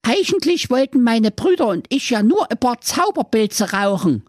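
sehr hohe, piepsige Zicklein-Stimme, leicht japsend; hoch und quäkig wie ein junges Zicklein; kleinstes Geißlein. Acted. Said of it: "das Geißlein hub an". (hohes Geißlein-Gepiepse nach der Drehtür)

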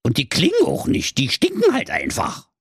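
squeaky Berlin-tinged dwarf, chatty; a cheeky high gnome voice. Acted. The dwarf explains the world edge to Marco, squeaky.

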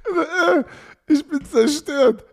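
high, strained, wrecked-voice impression; imitating a hoarse voice. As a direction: squeak it out like a voice that's gone. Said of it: "Nur meine Stimme ist weg".

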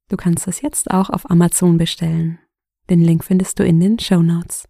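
loud, brisk promo read. Acted. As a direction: sell it like a podcast ad. Werbung am Anfang. (lauter Werbesprecher-Ton)

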